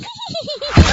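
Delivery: mocking laugh. Mocks the pie rack.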